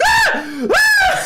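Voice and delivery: high, loud panicked scream; piercingly high shrieks of terror. Retold or imitated. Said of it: "screaming like".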